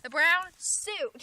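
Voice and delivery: The brown suit drawn out slow and loud; slow emphatic title. Shown as a demonstration. Reading the title to the dog, slow and loud. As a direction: say The brown suit slowly with weight. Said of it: "re-reading title slowly".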